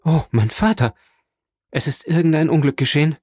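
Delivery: high, fast frightened cry; alarmed exclamation. Acted. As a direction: blurt it out in alarm. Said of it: "mit dem Ausruf entgegenlief".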